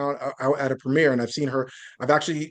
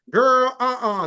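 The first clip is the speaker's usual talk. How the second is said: mock sassy voice; 6 semitones higher, 4 dB louder, slower than usual. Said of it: "don't talk in that".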